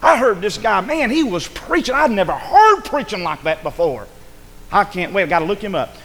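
high, loud, thrilled news for his wife; excited. Retold or imitated. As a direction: burst in with excitement. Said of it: "tell my wife".

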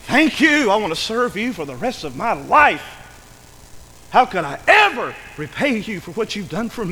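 loud, high burst of thanks, easing slightly; fervent, exclaimed devotion; overjoyed gratitude. Acted. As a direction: pour out thanks like a slave just set free. Acting a freed slave's thanks.

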